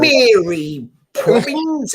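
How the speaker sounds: hammed-up Mary Poppins English, slow and high; mock posh English. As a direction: ham up a prim English nanny.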